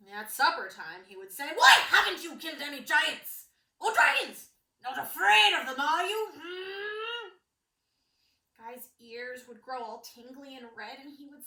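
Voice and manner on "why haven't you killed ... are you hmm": loud, much higher sneering father; taunting king. Acted. King presses his son at supper, loud and taunting. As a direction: goad him, loud and pushy.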